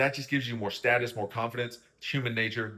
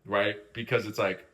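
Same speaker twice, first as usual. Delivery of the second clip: ordinary talk, nothing marked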